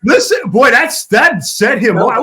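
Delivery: loud and high teasing call; loud, high, laughing reaction. Real, not acted. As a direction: crow at the host's outburst. Co-host reacts to the outburst.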